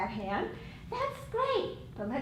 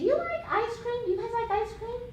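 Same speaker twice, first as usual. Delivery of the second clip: bribing adult; 9 semitones higher, faster than usual. Acted. Teacher acts a bribing adult.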